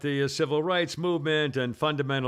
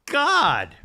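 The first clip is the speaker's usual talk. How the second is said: exasperated; 10 semitones higher, 7 dB louder than usual. Real, not acted. Exasperated about cop attacks.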